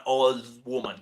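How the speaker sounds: ordinary talk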